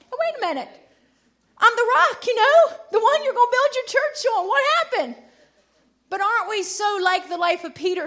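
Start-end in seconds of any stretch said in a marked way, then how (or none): 0.1-5.2 high-pitched mock bewilderment; taken-aback disciple. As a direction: protest like a favorite suddenly rebuked. Peter acted, taken aback by rebuke.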